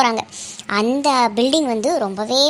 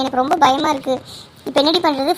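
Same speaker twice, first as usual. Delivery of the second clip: ordinary talk, nothing marked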